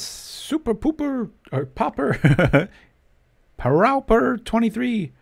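high, slow, drawn-out goofy voice; a playful mock-announcer voice for viewer names; silly name reading.

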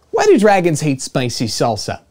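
usual speaking voice

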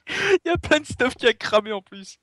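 high voice still breaking into laughter; laughing. Real, not acted. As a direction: say it through giggles. After lava, still laughing.

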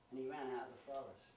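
ordinary delivery, nothing marked

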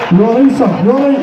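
high, urgent "you all right"; panicked high call to a limp man; alarmed calling out. Retold or imitated. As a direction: shout at him to come round. Reviving the man he beat.

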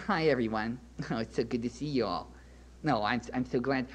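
soft, low-pitched parody of an unmanly man; mock sissy voice. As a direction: coo a hammed-up, dainty greeting. Acting an unmanly 'real man'.

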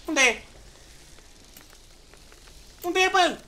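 high, loud, mounting bewilderment; urgent searching call. Real, not acted. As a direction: demand to know, rising on the repeat. Searches for vanished opponent. (întrebare nedumerită, tot mai înaltă)

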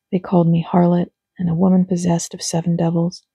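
plain reading voice

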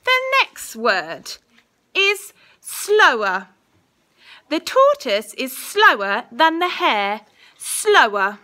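slow, clear dictation voice; school dictation. Acted. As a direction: say each word carefully for spelling. Teacher dictating a spelling test.

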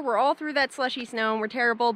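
ordinary delivery

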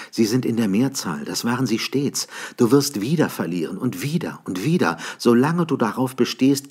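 a woman begging him to leave; pleading woman. A narrator's reading. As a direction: plead with him to come away.

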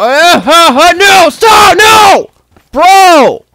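high, loud panicked yells; real panic as zombies close in; panicked shouting. Real, not acted. Zombies attacking him.

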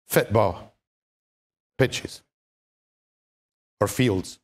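Scottish accent, football as fitpol; a broad Scottish lilt on football. Shown as a demonstration. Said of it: "if I use my Scottish accent".

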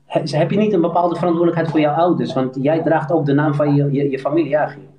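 normal talking voice

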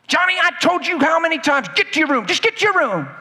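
high, scolding mock yell at Johnny; mock angry parent. Parent ordering Johnny to his room, mock scolding. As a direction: play a fed-up parent snapping at Johnny.

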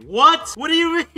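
loud, high-pitched incredulous yell; shocked disbelief. Real, not acted. Gamer reacts to a player denying the trade, shocked. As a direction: burst out in utter disbelief.

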